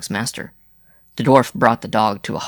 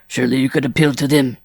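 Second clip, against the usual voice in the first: raised, louder urging; persistent; 4 semitones higher, 5 dB louder than usual. A narrator's reading. Rider urging Jean to seek help, persistently. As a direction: push for an answer, insistently. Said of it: "LaFleur persisted".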